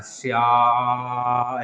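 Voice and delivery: श्या- with the आ held very long; stretched shyaa. Shown as a demonstration. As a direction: pull out the vowel of श्या- as if calling. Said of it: "ऐसे ऐसे बन के आ रहा है ना".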